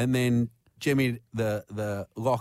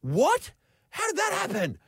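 confused man. Retold or imitated. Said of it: "confused male voice from downstairs go".

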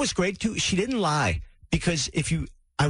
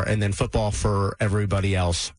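normal talking voice

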